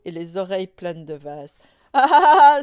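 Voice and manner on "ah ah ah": very loud, high "ah ah ah" laugh; bursting mock laughter. A narrator's reading. Nini seeing papa fall in, laughing uproariously. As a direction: burst out in a big acted cackle. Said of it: "elle éclata d'un rire".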